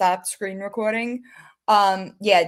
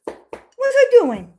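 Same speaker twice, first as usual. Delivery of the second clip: high, slow cooing pet voice; 8 dB louder, much slower than usual. Real, not acted. Owner cooing at her cat, high baby talk.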